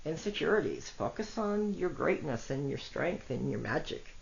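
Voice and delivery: normal talking voice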